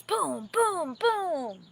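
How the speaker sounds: loud, high, punched booms; booming sound effect. A narrator's reading. Narrator booming out the magic bolts striking. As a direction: blast each boom like a thunderbolt.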